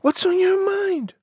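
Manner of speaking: very high falsetto question; high girlish phone voice. A narrator's reading. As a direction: ask it as a piping young lady.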